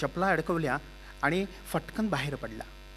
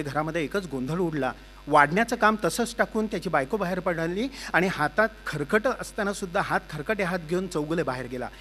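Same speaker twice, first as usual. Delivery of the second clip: ordinary delivery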